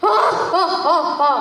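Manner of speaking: hearty mock-giant ho-ho; hammed-up giant chuckle; booming giant laugh. A narrator's reading. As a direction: laugh like a huge monster.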